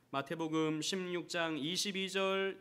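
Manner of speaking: ordinary talk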